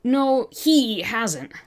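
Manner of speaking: slow, firm, defensive; defending Bob to the officer, slowly and firmly; sharp tone change. Acted. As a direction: bite back each word slowly. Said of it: "your tone change".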